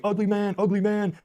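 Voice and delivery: high taunting chant; kids screaming. Retold or imitated. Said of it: "They're all just screaming".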